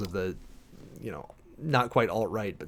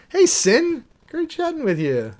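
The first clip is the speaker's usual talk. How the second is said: much higher, louder, bright hello; a cheery shout-out to a streamer; excited greeting; 7 dB louder than usual. Real, not acted. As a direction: call out happily to a friend.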